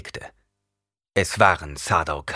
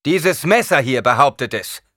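triumphant; 6 semitones higher, 7 dB louder than usual. A narrator's reading. Holds the knife up.

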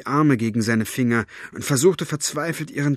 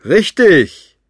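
sudden loud high shout; villain's abrupt triumphant cry; sharp exclamation; 8 semitones higher, 13 dB louder than usual. A narrator's reading. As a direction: bark the word out triumphantly.

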